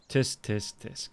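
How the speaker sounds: mock disapproval. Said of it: "disapproving tut".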